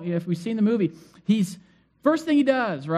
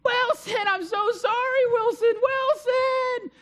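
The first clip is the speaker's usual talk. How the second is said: hammed-up tearful wail, voice far higher; crying out; much slower than usual. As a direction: wail it in mock anguish, stretching the name. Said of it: "He starts crying, and he's going".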